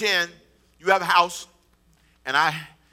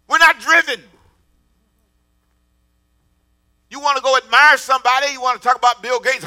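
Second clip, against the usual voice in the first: full-force preaching; 4 semitones higher, 9 dB louder than usual. Acted. Pastor challenging the congregation about passion, loud preaching.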